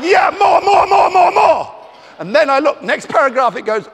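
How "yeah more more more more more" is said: very high, loud greedy shout; ecstatic yell. Acted. Author yelling for more praise from the fan letter. Same words yelled higher.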